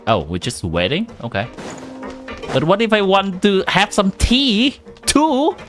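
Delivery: normal talking voice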